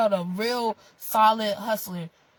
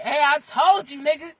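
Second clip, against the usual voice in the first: louder, higher mock I-told-you-so; gloating; 6 semitones higher, 6 dB louder than usual. Acting out someone back to say I told you so, gloating. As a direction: crow it at them.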